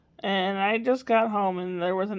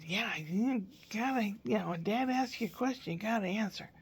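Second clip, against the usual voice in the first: soft instruction; 8 dB quieter than usual. Real, not acted. Grandma quiet teaching.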